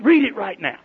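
lightly hammed-up stern command; mock bossy order. Announcer joking to daughter about her father's letter, mild mock bossy order. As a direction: playfully bark the order like a strict messenger. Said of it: "Just joking".

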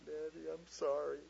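slow, put-on little-kid voice; imitating a contrite daughter; apologetic child. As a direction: plead slowly like a child sticking to a fib. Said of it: "went like this".

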